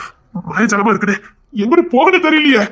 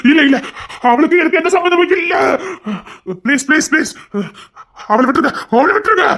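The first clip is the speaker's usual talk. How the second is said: desperate plea; 4 semitones higher than usual. Acted. Father pleads for his daughter.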